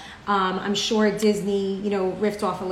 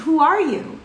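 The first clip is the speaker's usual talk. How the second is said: a hint of a booming giant, higher and louder; touch of a surprised giant calling out; lightly voiced giant; 7 semitones higher, 6 dB louder, slower than usual. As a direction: play the startled giant, a little raised. Giant meets the Vizier in desert.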